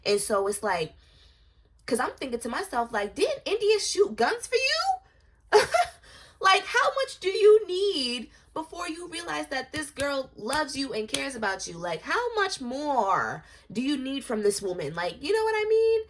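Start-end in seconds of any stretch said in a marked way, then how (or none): 3.1-5.7 high, slow, incredulous question; outraged disbelief, pitched high and loud; exasperated. Acted. Exasperated rhetorical question at Durk. 6.4-16.0 exasperated. Real, not acted. Exasperated rant at Durk.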